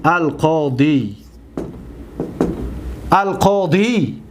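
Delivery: القاضی drawn out slowly, every sound spelled clearly; slow model word. Shown as a demonstration. Teacher modelling القاضی slowly for the class. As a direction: read القاضی slowly, with a long final ی. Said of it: "دقت بتید".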